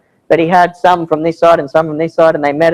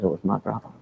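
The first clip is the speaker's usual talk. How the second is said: a hushed, breathy whisper, much softer; 9 semitones lower, 14 dB quieter, faster than usual. Retold or imitated. A villager whispering who shot Simon. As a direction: lean in and whisper the secret. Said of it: "he whispers to me afterward".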